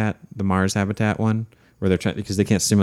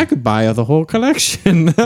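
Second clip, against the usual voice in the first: high, louder, delighted scheming; gleeful voice of a bargain hunter; giddy greedy thought; 9 semitones higher, 5 dB louder than usual. Retold or imitated. Telling friends about refunds, high gleeful inner voice. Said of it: "It's like".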